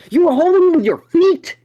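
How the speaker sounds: very high, loud disbelief; retold shout of utter disbelief; incredulous yell. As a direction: screech the question in outrage. Retold disbelief at Ben's excuse.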